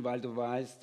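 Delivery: ordinary talk, nothing marked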